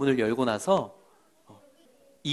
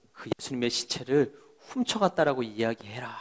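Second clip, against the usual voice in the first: softer, hushed command; secret order; 4 semitones lower, 9 dB quieter than usual. Acted. Priests quietly ordering the soldiers to lie. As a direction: whisper a bribe to the guards. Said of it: "라고 이렇게 이야기한 거예요".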